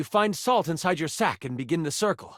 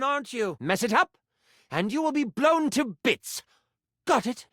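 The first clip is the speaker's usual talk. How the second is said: scolding enchanter, climbing much higher as it goes; exasperated mentor; 5 semitones higher than usual. Acted. Mentor warns apprentice off capturing it alone, exasperated. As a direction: instruct firmly, then rise into a warning.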